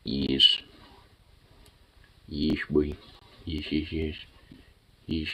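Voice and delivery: hushed, tender murmurs to a dog; soft cooing. Real, not acted. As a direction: coo quietly at the puppy.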